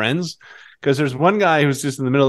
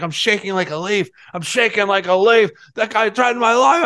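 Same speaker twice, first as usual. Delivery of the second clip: imitating a shaken golfer; 6 semitones higher than usual. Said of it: "He's like".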